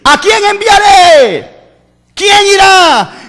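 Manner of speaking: high, slow, calling voice; God asks who will go, high and slow; voice of the Lord. Acted. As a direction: call out as God seeking a messenger. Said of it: "la voz del Señor que dice".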